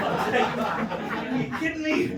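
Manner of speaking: drawn out through real laughter; can barely get the words out for laughing. Real, not acted. Laughing while retelling his disbelief to the host.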